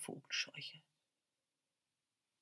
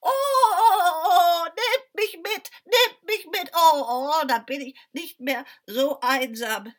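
high, loud cackling scarecrow cries; croaking scarecrow; 9 semitones higher, 12 dB louder than usual. Acted. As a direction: crow and cackle like a giddy scarecrow. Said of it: "sagte die Vogelscheuche".

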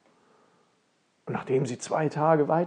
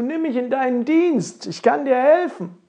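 high, loud eager offer; raised-voice plea to be hired; calling out; 9 semitones higher, 9 dB louder than usual. Acted. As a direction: call it up loudly to the prince. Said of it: "rief nun dem Prinzen zu".

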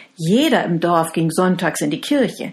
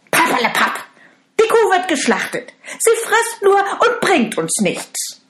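much higher, loud with a scornful laugh; mocking stepmother; 13 semitones higher, 6 dB louder than usual. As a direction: laugh off his worries. Stepmother dismisses husband.

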